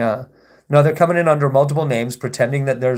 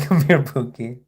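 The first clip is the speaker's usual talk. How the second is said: laughing tease. Acted. Teasing coo at a troll.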